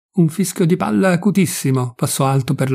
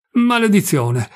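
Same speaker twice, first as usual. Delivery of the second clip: higher-pitched cry of dismay; restrained exclamation, pitched up; cried curse; 7 semitones higher, slower than usual. A narrator's reading. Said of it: "gridò l'ufficiale accorrendo".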